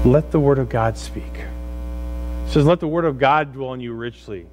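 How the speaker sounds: normal talking voice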